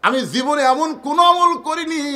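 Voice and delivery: loud, high impassioned cry; high-pitched preacher's outcry. Acted. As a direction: shout it out as a desperate protest. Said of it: "চিৎকার করে বলে".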